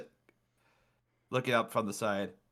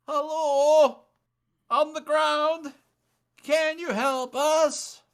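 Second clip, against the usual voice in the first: high-pitched woman calling from the wreck; weak voice; 6 dB louder, slower than usual. A narrator's reading. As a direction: call up for help, high and slow. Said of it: "A weak voice calls out".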